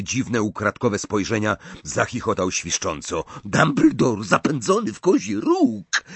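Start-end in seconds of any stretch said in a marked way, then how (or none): 3.4-6.0 high, gleeful crowing shout; sniggering Death Eater gloating over cornered Dumbledore; gleeful call. A narrator's reading. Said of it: "zawołał".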